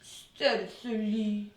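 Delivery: drawn out slowly, stealthily; slow and sly. Acted. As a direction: creep through it like a cat. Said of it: "just like a cat watching a mouse".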